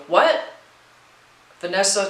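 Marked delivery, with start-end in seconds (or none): 0.1-0.4 sudden high startled what. A narrator's reading. Vanessa startled by the growling dog, sharp surprise. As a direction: jump at the sound behind her. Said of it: "Vanessa is startled".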